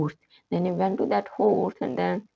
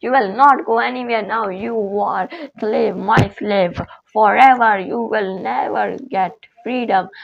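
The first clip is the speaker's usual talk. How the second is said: loud, higher angry outburst at the slave; raised, angry voice full of command; angry master; 3 semitones higher, 6 dB louder than usual. Acted. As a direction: bark it down at the slave like a furious master. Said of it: "the slave master became so angry".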